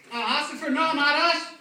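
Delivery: put-on high loud slurred drunk pleading; high, loud, slurred drunk denial. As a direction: drunk begging the officer, slurring.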